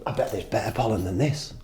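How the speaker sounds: a hint of a laid-back rogue bee. Rogue bee muttering about better pollen, lightly voiced. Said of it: "that's how they speak".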